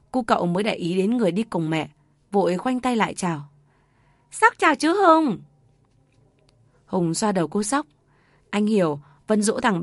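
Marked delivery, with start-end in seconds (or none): 4.3-5.4 put-on much higher, innocent child voice; little boy. A narrator's reading. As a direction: chirp it like a small boy. Boy greets uncle Hùng. (cậu bé nói với mẹ, giọng trẻ con cao)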